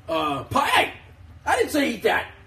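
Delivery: high, sudden cry at the dog; startled scolding. Real, not acted. Owner catches her dog eating the wrong thing, startled high scold. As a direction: catch the dog stealing food, pitch jumps. Dog grabs food unexpectedly.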